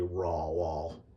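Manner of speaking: "raw wall" with an open aw in both words; wrong vowel. Shown as a demonstration. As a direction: say "raw wall" as two matching aw syllables. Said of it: "isn't just a W".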